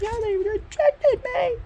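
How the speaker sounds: whining to mom about the rejection; mock moping whine.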